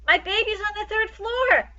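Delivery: high, frantic mother's cry; screaming mother. A narrator's reading. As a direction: cry out in terror for her baby.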